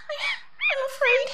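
high, trembling-edged little I'm afraid; a scared child pleading; frightened. Acted. Woman frightened of a caterpillar in her dream, high and scared.

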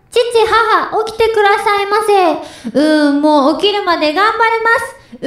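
put-on high cute anime girl voice; Anya-style anime voice. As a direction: chirp it like a little anime girl. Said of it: "アーニャ風お願いします。".

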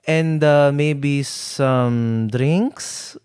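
slow, drawn-out attempt at Lolo's accent; attempted grandpa accent. Grandson retells Lolo asking for drinks, badly attempted grandpa accent. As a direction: imitate grandpa's accent, stretching the last words into a question. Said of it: "I can't do the accent".

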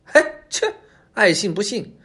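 high-voiced fox brushing it off; huffy little fox; dismissive scoff. A narrator's reading. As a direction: scoff sharply, then shrug it away. Fox scoffs after the departing crow.

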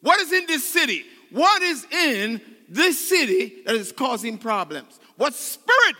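high, loud, pleading preacher cry; full-force preaching. Acted. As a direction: preach it, climbing with every line.

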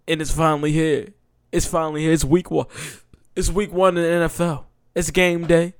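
pumped season opener; hyped up. Real, not acted. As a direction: announce it like kickoff is here. Hyped kickoff of football season.

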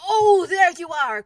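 slow, high, very loud greeting; drawn-out, carrying call. A narrator's reading. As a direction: holler it down with relief. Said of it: "called down Winnie the Pooh".

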